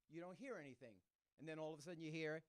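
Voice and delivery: usual speaking voice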